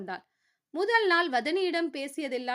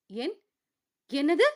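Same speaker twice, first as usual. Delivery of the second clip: stammering; 2 semitones higher, 6 dB louder than usual. A narrator's reading. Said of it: "என்று தடுமாறி கேட்டவளுக்கு".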